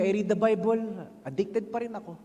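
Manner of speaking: normal talking voice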